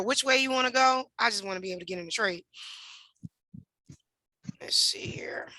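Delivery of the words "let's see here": whisper. Real, not acted. Muttering to himself at the chart.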